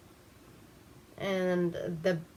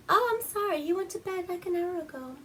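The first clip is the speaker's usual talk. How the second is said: much higher, apologetic housewife; high wife voice; 11 semitones higher, faster than usual. Retold or imitated. Wife telling the visitor her husband is in bed, high polite voice. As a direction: play the polite fifties wife, pitched up. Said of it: "she's like".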